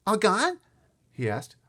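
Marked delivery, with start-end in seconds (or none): 0.1-0.6 put-on high, loud toddler question; small boy asking. A narrator's reading. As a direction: ask like a startled tiny kid. Small child asks about teeth.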